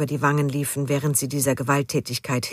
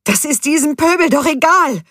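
high, loud shrill outburst; shrill and upset; 12 semitones higher, 8 dB louder than usual. A narrator's reading. Judith snapping at Gunther about the mob, high loud shrill. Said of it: "Judiths Stimme klang schrill".

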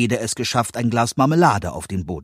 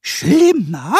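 hohe, laute Koboldstimme, entrüstet fragend; empörter Kobold; 11 semitones higher, 8 dB louder than usual. A narrator's reading. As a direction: Kobold fühlt sich beleidigt. Pumuckl empört. (Pumuckl hakt empört nach, hoch und laut)

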